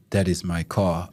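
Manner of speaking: mock European accent, low and soft. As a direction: drop low for a foreign European man's voice. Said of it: "That's your European accent?".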